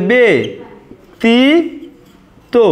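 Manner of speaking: ব্যতীত split as "be, ti, to" with long pauses; syllable by syllable. Shown as a demonstration. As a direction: say it in parts "be... ti... to". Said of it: "ব্যতীত উচ্চারণটা এমন হবে".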